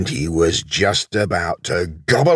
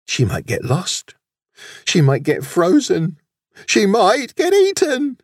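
rising higher and louder in panic; worry building to howl; 3 dB louder than usual. A narrator's reading. Wolf fearing for the lost lamb, panic building to howling. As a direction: start anxious, climb to an anguished howl. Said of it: "he howled".

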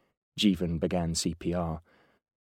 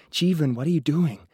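higher, louder hissed challenge; urgent hiss; 8 semitones higher, 5 dB louder than usual. A narrator's reading. Girlfriend tugging at his sleeve, hissing at him. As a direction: hiss it in alarm at your partner.

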